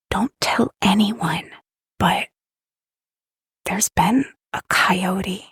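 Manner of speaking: hushed, secretive whisper; stage whisper. Acted. Player's character confiding in a guide on tiptoe, whispering. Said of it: "I'm gonna whisper".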